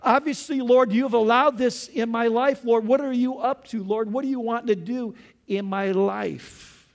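raw, desperate lament; anguished prayer. Acted. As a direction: cry out to God in hurt confusion. Anguished prayer, questioning God.

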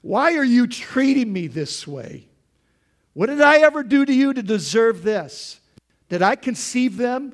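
loud, high, exasperated complaint to God; Moses complaining. Retold or imitated. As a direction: hurl the grievance upward, fed up. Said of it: "Moses said to God".

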